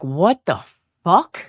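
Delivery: slow, stretched-out puzzled reaction; re-enacted disbelief. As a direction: drag the words out, imitating bewilderment. Re-enacts reaction while showing video.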